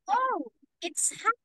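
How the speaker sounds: high, exaggerated "Oh" at the start; exaggerated exclamation. Shown as a demonstration. Teacher showing how an exclamation gives emphasis, exaggerated. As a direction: exclaim it, leaning hard on "Oh". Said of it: "you are actually exaggerating".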